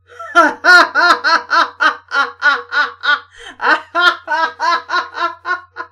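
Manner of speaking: loud, helpless burst of laughing; real uncontrollable laughing fit; helpless laughter. Real, not acted. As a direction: crack up at the typo. Laughs at mistype.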